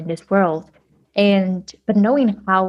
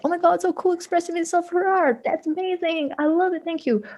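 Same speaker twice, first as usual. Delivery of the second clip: much higher, gushing fan voice; gushing admirer; 10 semitones higher than usual. Retold or imitated. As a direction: gush over it like an excited fan. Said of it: "It's like".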